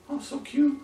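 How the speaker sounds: high, soft cooing; cooing at a puppy. Retold or imitated. Owner cooing over a new puppy, high and soft. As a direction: gush over a tiny puppy. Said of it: "A little Rottweiler puppy".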